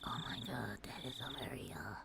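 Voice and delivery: barely audible whisper to self; hushed whisper. Real, not acted. Streamer facing a hard title to say, hushed whisper. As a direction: whisper it under the breath.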